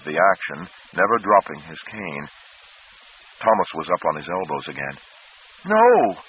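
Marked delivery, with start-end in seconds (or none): 5.7-6.2 much higher, loud desperate no; almost scream it in panic; a boy's frantic, shrieking no; near scream. A narrator's reading. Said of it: "he nearly screamed".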